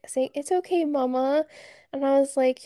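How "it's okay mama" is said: put-on high sweet little boy voice; lightly voiced comforting toddler.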